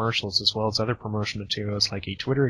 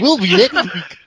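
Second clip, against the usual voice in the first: loud, high laughing echo of a flub; louder, higher, incredulous and amused; mocking echo; 7 semitones higher, 9 dB louder than usual. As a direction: repeat the blunder back, cracking up. Mocking echo of the flubbed sign-off.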